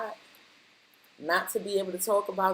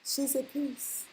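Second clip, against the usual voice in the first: soft and slow; 5 semitones higher, 8 dB quieter, slower than usual. Shown as a demonstration. Repeating the peace message.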